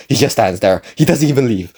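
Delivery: ordinary delivery, nothing marked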